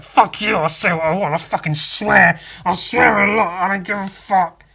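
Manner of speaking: mock rebellious outburst against being told to stop swearing; defiant shout. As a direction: bellow it like a rebel who won't be told. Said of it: "part of me wants to be like".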